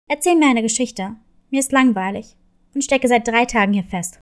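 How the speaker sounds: low, fast, demanding wolf; a stuck wolf grumbling for a story; bored wolf. Acted.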